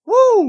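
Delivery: high shrill woo, full volume; forced cheer. Shown as a demonstration. Woo to his viewers, whooped at full volume. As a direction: whoop it out like a hype host. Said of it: "let me try that again".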